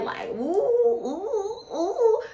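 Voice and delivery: very high, swooning ooohs; high giddy oohs of infatuation. Pretend. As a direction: squeal like the hormones just kicked in. Chemicals popping off, giddy swoon.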